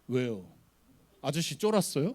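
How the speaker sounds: put-on mildly cocky boy's jeer; snotty kid. Small boy sneering up at pastor in queue, snotty challenge. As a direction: throw it back like an unimpressed brat. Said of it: "띠꺼운 표정으로".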